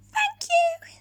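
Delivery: very high, loud squeaky thanks; squeaky mice. Acted. Baby mice thanking George for the shoe house, squeaking high. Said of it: "they squeaked".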